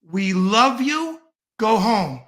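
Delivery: slow, drawn-out mock Trump quote; quoting Trump. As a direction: stretch every word like a fake soothing leader. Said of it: "and I quote".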